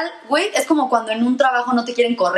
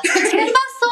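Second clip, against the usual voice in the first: nosy aunt; 12 semitones higher, much slower than usual. Retold or imitated. Mimicking the aunt mid-hug.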